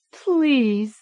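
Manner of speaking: high, loud begging; begging child. A narrator's reading. Girl begs the doctor to stop, high desperate begging. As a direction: beg them to stop.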